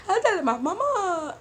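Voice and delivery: high loud whiny imitation; mock whining girl. Girl after the fight crying for her mom, high whiny mock voice. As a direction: wail it like a kid tattling.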